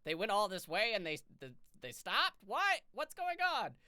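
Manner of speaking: high, loud disbelief; mock outrage. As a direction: play up the shock, loud. Mimicking players' outrage.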